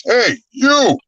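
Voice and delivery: calling out. Retold or imitated. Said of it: "And I hear the voice".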